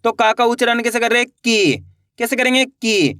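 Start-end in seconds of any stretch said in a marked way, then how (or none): 1.4-1.8 की with the ee pulled long; की, held long for learners; drawn-out long vowel. Shown as a demonstration. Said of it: "खींच के करेंगे". 2.7-3.1 की with the ee pulled long; की, held long for learners; drawn-out long vowel. Shown as a demonstration. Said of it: "खींच के करेंगे".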